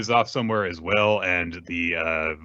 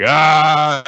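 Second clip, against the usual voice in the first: mock gasp; 8 semitones higher, 9 dB louder than usual. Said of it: "And everyone's like".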